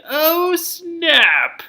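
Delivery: high, loud gossip gasp; a big high "oh snap" at the drama; mock shock. Real, not acted. Reacting to gossip quote.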